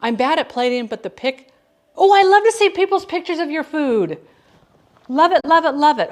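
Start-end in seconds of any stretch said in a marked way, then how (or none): 2.0-6.1 higher and louder, gushing over the photos; gushing delight. Real, not acted. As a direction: rave about it, bubbling over. Gushing over viewers' food photos.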